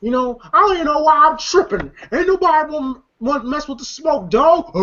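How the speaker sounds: lightly voiced high, fast Smokey; a hint of Smokey's bravado; Smokey impression. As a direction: brag it off nervously like Smokey. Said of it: "And then Smokey once again".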